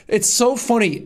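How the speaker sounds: ordinary talk, nothing marked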